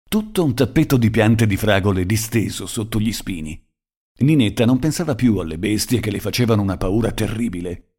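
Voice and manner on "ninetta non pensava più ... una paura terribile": plain reading voice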